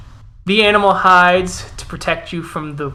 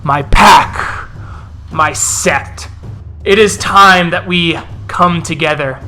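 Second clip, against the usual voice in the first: forceful rallying speech, loud bursts on key words; rally the pack as a fierce elder; commanding war-leader with weighty pauses; growling wolf elder; 4 dB louder, slower than usual. Acted. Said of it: "As he growls and snaps".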